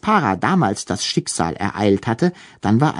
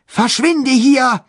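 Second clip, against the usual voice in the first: trembling high cry; shaky voice; 12 semitones higher, 4 dB louder, slower than usual. A narrator's reading. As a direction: shout it with a quivering voice. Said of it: "rief er mit bebender Stimme".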